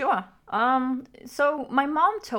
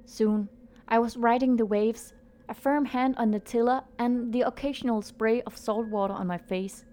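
story narration. A narrator's reading. Reads the story over music.

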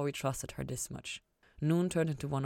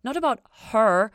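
quick, louder, higher hurried reassurance; hasty; 7 semitones higher, 10 dB louder than usual. A narrator's reading. Ella hurriedly correcting Lucifer, quick higher louder denial. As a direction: rush to correct him. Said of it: "Ella hastened to say".

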